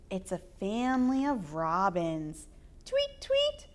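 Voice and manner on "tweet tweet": very high little tweets; bird chirps. Acted. Reading to kids, very high baby bird tweets.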